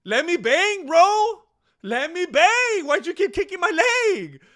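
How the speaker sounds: high, loud mock complaint of a beaten fighter; a hammed-up crybaby fighter whining to his opponent. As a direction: whine like a sore loser begging to fight. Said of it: "he's basically crying to him".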